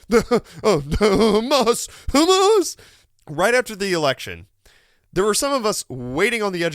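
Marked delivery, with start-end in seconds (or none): 0.1-2.8 flustered stammer, rising to a high loud yelp; mock the mayor groping for an answer; mocking Adams. Mocking Mayor Eric Adams stammering an answer, high and loud. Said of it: "the most he could say was just".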